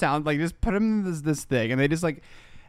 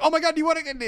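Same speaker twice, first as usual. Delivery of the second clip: mock excited; 13 semitones higher, faster than usual. Acting out the excited guide.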